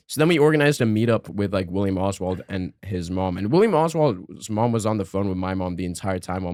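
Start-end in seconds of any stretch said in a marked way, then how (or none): none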